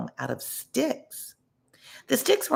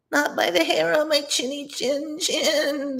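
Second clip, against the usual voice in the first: much higher, slow tiny pig voice; high squeaky piglet; 13 semitones higher than usual. A narrator's reading. Second little pig refuses the wolf, high and squeaky. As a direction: squeak back defiantly. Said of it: "the second little pig replied".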